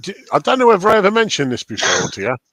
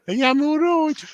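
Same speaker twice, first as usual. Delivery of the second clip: put-on veel hoger nep-Brummie accent; 13 semitones higher than usual. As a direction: vraag het in overdreven Brummie. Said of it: "I make fun of every accent, including Brummie".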